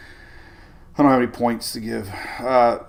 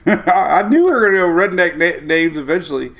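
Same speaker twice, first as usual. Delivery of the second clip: talking through laughter; giggling while admitting it; laughing; 6 semitones higher, 6 dB louder than usual. Real, not acted. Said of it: "can't stop laughing".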